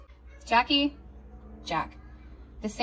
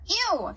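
disgusted ew; 9 semitones higher than usual. Acted. Toddler refuses ribbons.

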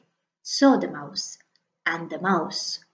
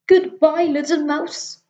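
higher, louder startled snake; a snake caught off guard; alarmed snake; 7 semitones higher, 5 dB louder than usual. Acted. Snake spotting the Gruffalo, higher and louder in alarm. Fox leaves.

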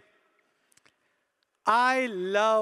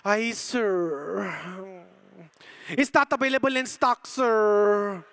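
apologetic imitated Filipino accent with long-drawn sir; mock-sorry Filipino service voice; Filipino shop assistant; 2 semitones lower, much slower than usual. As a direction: play a regretful Filipino salesgirl. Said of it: "she goes".

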